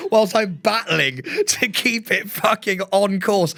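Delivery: ordinary talk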